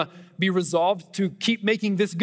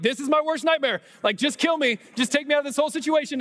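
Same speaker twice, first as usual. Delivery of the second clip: much higher, frantic despair; panicked; 10 semitones higher than usual. Retold or imitated. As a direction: retell a mortified inner wail. Panicked inner thought chasing dog.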